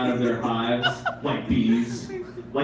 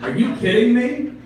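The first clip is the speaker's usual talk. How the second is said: incredulous pigeon; 9 semitones higher, 4 dB louder than usual. Acted. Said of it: "pigeons talk".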